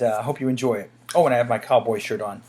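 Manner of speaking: ordinary talk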